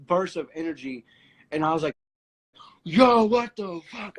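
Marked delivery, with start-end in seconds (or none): 2.8-4.2 high, loud, slow disbelief; imitating own stunned reaction; shocked outburst. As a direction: let the shock burst out.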